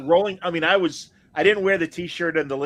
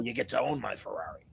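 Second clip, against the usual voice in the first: low, quiet and tough; low tough-guy line; 6 semitones lower, 11 dB quieter than usual. Retold or imitated. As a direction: lower the voice and play it cool. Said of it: "he's like, uh".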